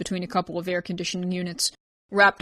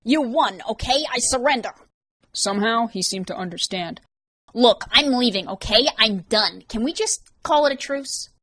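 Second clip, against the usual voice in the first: nervous pleading; 4 semitones higher, 4 dB louder than usual. Acted. Vance surrenders, pleading.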